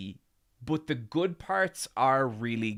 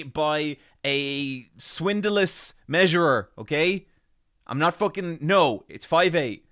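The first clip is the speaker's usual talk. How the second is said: mock outrage; 7 semitones higher, 10 dB louder than usual. Real, not acted. Indignant protest about height.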